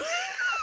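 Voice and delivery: sudden high-pitched laugh; high burst of laughter. Retold or imitated. Laughing at the man who called him a coward, high laugh. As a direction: squeal a laugh of disbelief.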